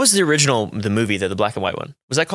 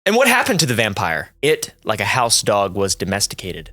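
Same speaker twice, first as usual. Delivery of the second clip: solemn narration over music; dramatic reading; 2 semitones lower than usual. Acted. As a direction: read it like a spooky essay. Reads a spooky essay aloud.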